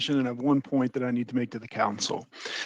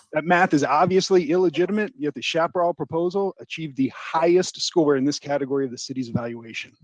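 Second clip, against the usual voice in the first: ordinary talk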